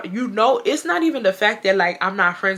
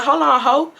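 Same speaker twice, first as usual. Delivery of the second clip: raised, higher firm warning; firm callout; 6 semitones higher, 4 dB louder than usual. Retold or imitated. Telling Zebra off in the group chat, firm raised voice. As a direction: cut her off hard in the chat. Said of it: "I told her straight up".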